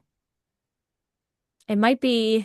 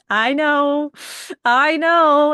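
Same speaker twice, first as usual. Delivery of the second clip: laughing; 6 semitones higher, 7 dB louder, much slower than usual. Real, not acted. Laughing at spouse question.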